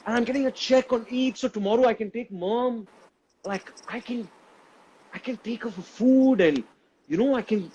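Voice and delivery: high, bright rush of retold joy; giddy, disbelieving delight at the good news; retold excitement. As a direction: relive the thrill of the dream coming true. Retold joy over getting paid.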